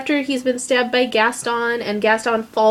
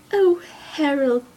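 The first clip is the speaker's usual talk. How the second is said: slow, slightly high, gentle imitation of a film character; tender quoted reply; 4 semitones higher, slower than usual. Maud tenderly answering Harold's declaration of love, slow and gentle.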